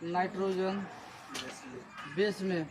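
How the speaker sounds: quietly spaced out for copying; slow dictation. Real, not acted. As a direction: dictate it softly, piece by piece. Repeats in pieces for students writing.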